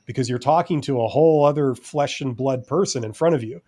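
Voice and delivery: ordinary talk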